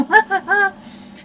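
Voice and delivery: high, loud laugh. Real, not acted. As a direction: laugh at your own remark. Laughs at own remark.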